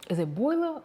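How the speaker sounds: boiler with a New York accent, high. Shown as a demonstration. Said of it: "my New York accent just came out".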